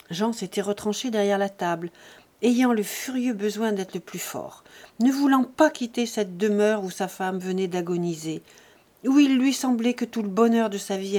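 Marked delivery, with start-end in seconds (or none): none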